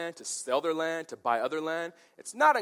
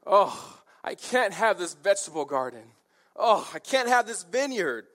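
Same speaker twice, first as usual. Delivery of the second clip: mock pouty king, louder and higher; whiny tantrum; 4 semitones higher, 4 dB louder than usual. Pouting king bemoaning the vineyard he can't have, tantrum whine. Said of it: "he's just throwing a temper tantrum".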